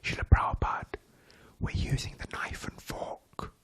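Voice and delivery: unvoiced, hushed whisper at the table. Disciple whispers to Prabhupada at the lord's table, in a whisper. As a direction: whisper it so the host won't hear. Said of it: "one of the disciples whispered".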